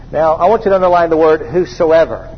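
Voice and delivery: usual speaking voice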